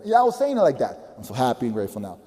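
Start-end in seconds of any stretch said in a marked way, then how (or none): 1.2-2.2 low, fast, lifeless mumble; bored half-hearted recital; no energy. Shown as a demonstration. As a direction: rattle it off like a chore. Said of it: "That's the way I was saying it".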